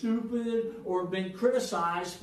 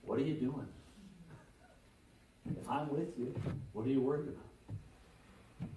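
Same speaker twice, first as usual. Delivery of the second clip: soft, low, unhurried voice of God; gently lowered, calm reassurance; still, calm voice; 6 semitones lower, 9 dB quieter than usual. Acted. God speaking to Elijah on the mountain, softly and calmly. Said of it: "but in the still, calm voice".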